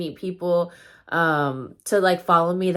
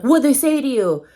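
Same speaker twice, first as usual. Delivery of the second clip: louder, higher mock outrage; confrontational demand; 7 semitones higher, 6 dB louder than usual. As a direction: imitate a son jumping in to confront. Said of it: "kind of acted that way".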